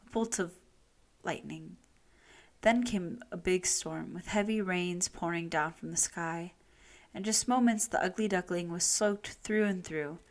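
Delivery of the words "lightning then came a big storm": plain reading voice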